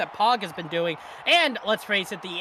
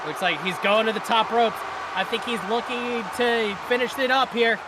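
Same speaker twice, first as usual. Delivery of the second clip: wrestling commentator; 3 semitones higher than usual. Acted. Live wrestling play-by-play.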